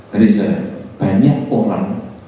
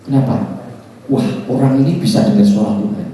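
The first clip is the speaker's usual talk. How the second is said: ordinary talk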